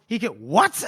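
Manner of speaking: rising disbelief on WHAT, lightly acted; mock-baffled, voice swinging up on "what"; incredulous question. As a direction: question it as if you misheard. Retelling a character's incredulous reaction.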